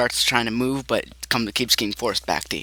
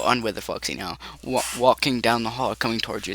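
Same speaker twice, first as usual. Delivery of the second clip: ordinary talk, nothing marked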